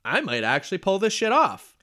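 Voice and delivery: high, loud thrilled disbelief; excited disbelief. Retold or imitated. Lifter mid-deadlift realizing the bar moves, excited disbelief. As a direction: gasp it out like the bar just moved. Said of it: "I'm kinda thinking to myself".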